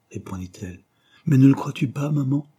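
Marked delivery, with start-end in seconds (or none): 1.3-2.5 insistance. A narrator's reading. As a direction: question pressante, plus haute et plus forte. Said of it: "persista-t-il". (insistant, voix qui monte)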